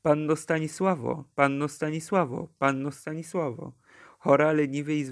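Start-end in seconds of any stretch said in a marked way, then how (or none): none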